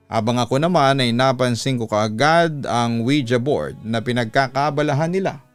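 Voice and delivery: ordinary delivery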